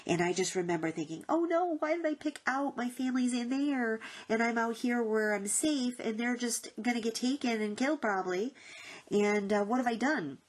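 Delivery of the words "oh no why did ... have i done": higher-pitched, acted-out distress; dismayed panic. Woman realising her family is left in danger, acted dismay. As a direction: relive the horrified thought, voice raised in pitch. Reliving her horrified thought in the dream.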